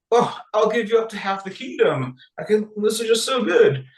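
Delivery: high-pitched mock royal delight; gushing king. Smitten king offering his queen a reward, hammed-up gushing. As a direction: play a besotted king overflowing with generosity.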